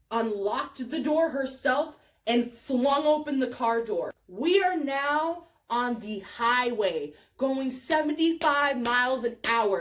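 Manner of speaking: ordinary delivery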